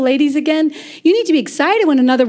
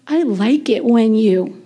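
low, slow, savoring; tender prayer; 5 semitones lower, slower than usual. Shown as a demonstration. Woman tells God what she likes, low slow tenderness. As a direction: lower the voice and linger lovingly.

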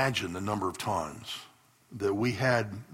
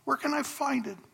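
lightly voiced pleading girl, rising high at the end; a pastor imitating a praying teen girl's desperate plea, mildly pleading; 9 semitones higher than usual. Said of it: "I hear her saying".

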